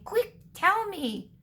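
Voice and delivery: high, drawn-out character voice; urgent demand. A narrator's reading. Caretaker urgently demanding an answer.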